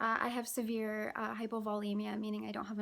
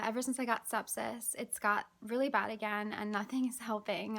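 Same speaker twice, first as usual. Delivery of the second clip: ordinary delivery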